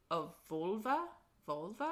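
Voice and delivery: "vulva" with a questioning rise; unsure pronunciation. Real, not acted. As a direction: try out "vulva", unsure it's right. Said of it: "Not sure".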